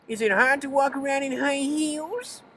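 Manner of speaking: mock a schoolyard bully in a squeaky high voice. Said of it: "that's a good bully voice".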